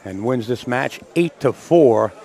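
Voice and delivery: loud, projected match-result announcement; sports announcer wrap-up. Real, not acted. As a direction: announce the winner and credit the booth, loudly. Announces result and thanks the booth.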